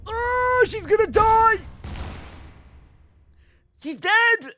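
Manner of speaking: high, loud startled cry; shocked outburst. Real, not acted. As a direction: yelp as the character dies. Reacting to a death in game.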